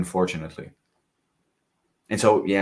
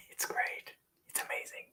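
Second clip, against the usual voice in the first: guilty whisper; 14 dB quieter than usual. Real, not acted. Reviewer admitting the overpriced scent is great, whisper. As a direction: whisper it like giving in. Whispered praise after laugh.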